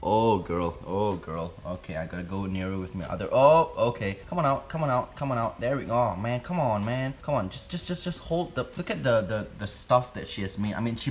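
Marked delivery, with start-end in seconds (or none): none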